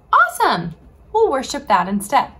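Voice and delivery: high, loud awesome, then eager; excited cheer. Acted. The people hail the golden calf with high excitement. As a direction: cheer the new idol.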